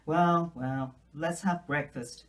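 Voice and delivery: a hint of a brusque older woman; brisk Marilla. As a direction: break up the moment, get down to business. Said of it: "interrupted Marilla".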